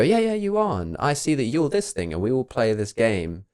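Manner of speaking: mock reassurance. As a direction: humour them like a child. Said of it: "And you go".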